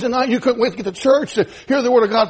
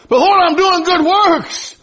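higher, slow protesting voice; defensive church; 7 semitones higher, 3 dB louder, slower than usual. Acted. Church protesting to the Lord, higher and slow. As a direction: protest slowly as the self-satisfied church. Church answering God in dialogue.

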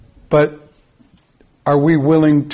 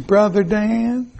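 slow, drawn-out fond call of a name; lightly voiced older woman calling his name; imitating a dear friend; 5 semitones higher, 4 dB quieter, slower than usual. Remembering a late friend calling him, slow and tender. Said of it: "I hear her voice saying".

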